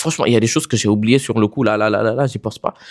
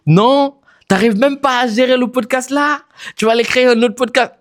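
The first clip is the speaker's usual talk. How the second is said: mocking listener voice; 12 semitones higher than usual. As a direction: play the doubtful listener scoffing. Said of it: "je sais ce que vous allez dire".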